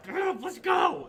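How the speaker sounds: high, slow, loud goblin shout; urgent goblin. Acted. Goblin shaking fallen buddy, urgent high shout. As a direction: yell to rouse your buddy.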